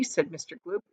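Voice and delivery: plain reading voice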